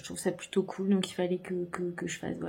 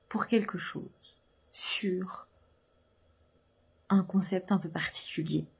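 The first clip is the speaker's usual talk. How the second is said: whispering. Real, not acted. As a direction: whisper it like a secret. Hinting at a secret project.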